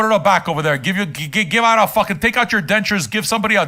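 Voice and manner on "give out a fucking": usual speaking voice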